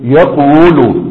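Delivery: يقول drawn out very slowly as yaqwulu, the waw carrying its own damma; original heavy form. Shown as a demonstration. Teacher showing students the heavy original form of يقول, yaqwulu drawn out slowly. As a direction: stretch يقول syllable by syllable, sounding the heavy yaqwulu. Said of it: "أصل يقول إيه؟".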